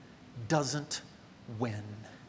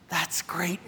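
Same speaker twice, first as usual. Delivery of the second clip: breathy slow whisper of good news; hushed, secret joy; 6 dB quieter than usual. Real, not acted. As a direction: lean in and whisper it, slowly. Whispered aside to congregation.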